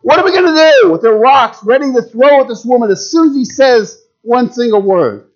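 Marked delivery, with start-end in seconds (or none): 0.0-0.9 high, loud screaming demand; furious crowd shouting at the teacher; mob yelling. Retold or imitated. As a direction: yell it like an angry mob. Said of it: "screaming, yelling".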